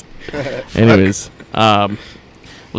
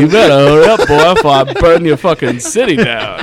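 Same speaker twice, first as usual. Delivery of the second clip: menacing villain; 4 semitones higher, 5 dB louder than usual. Acted. Mock slaver threatening a city.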